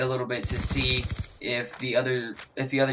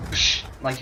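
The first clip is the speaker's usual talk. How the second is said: normal talking voice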